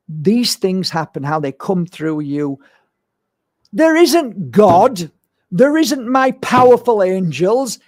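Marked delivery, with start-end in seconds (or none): 3.7-7.8 loud, higher, slowed denial; emphatic declaration. Real, not acted. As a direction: slam each word down like a verdict. Rejecting claims of divine channelling.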